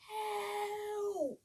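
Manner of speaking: mild mock cry for help; understated storybook scream. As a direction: call out in fright. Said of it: "Betty screamed".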